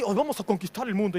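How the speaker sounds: a light mock of plotting pastors; pastors as Cerebro. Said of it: "son así".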